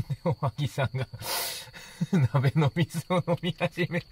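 talking through helpless laughter, high-pitched; real laughing. Real, not acted. Host reporting Ohagi drinking the pot water, cracking up. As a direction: laugh the words out, barely getting through them.

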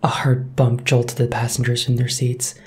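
usual speaking voice

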